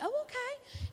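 high, bright-pitched surprised "oh, okay". Retold or imitated. Preacher's wife answering the hairspray lady, surprised. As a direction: squeak it out, caught off guard.

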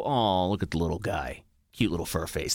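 fake-sweet coo for a friend's dog; mock cooing. Podcaster faking delight at a listener's dog photo, softly. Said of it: "That's what I'm gonna say".

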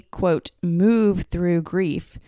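ordinary talk, nothing marked

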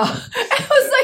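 laughing hard mid-sentence. Real, not acted. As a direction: crack up while saying it. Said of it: "It doesn't quite sound like that".

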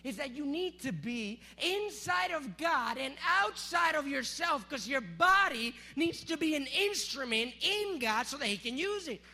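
loud, slow, shouted preaching climax; preaching at full force. Acted. Pastor pushing the church toward the message's peak, loud preaching. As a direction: drive it home to the church with everything.

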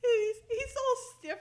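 real laugh through high, loud words. Real, not acted. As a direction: crack up while saying it. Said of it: "laughing at the scene".

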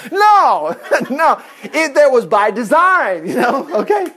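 loud, high, drawn-out no with laughter; emphatic denial. Real, not acted. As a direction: push it out high and emphatic, half amused. Emphatically rejects the mock quote.

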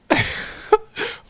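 breathy burst of laughter; real laugh. Real, not acted. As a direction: crack up at your own story. Laughs at own story.

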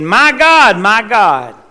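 loud anguished cry of "My God"; Jesus on the cross crying out loud to God. Acted. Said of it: "when he was crying out".

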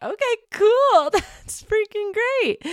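high, slow, laughing delight. Retold or imitated. Welcoming a first-time singer, high and laughing. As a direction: beam at someone who has never sung. Retells reaction to new singer.

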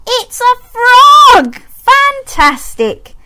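high, slow, delighted praise; reading to a toddler. Acted. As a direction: cheer a toddler on with big wonder.

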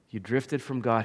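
usual speaking voice

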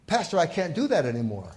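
upset groom. Retold or imitated. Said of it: "he's very upset".

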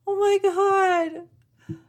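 high, loud, stretched-out gasp of surprise; shocked squeal. Retold or imitated. Retelling to viewers her shocked reaction to the puppy. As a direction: shriek it like the surprise just hit you.

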